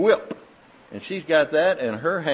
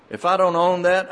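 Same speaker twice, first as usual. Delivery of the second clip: ordinary delivery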